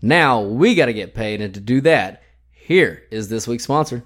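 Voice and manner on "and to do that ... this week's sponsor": normal talking voice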